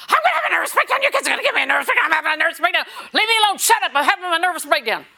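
very high, loud, fast frantic shriek; frazzled mother. Retold or imitated. Harried mother in a grocery store shrieking at her kids. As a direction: squeal like a mom at her wits' end. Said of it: "and she said".

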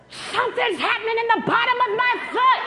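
high-pitched, frantic holler; excited yelling woman. Retold or imitated. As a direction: imitate a woman crying out in amazement. Acts out woman running after him shouting.

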